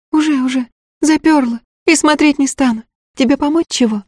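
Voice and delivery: put-on high, eager young servant girl; peasant girl voice. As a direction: pitch it up as a simple village girl.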